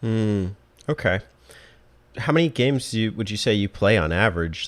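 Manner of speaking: ordinary talk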